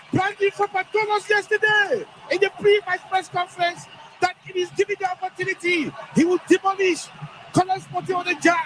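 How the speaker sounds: high, thrilled play-by-play after a goal; excited commentary. Real, not acted.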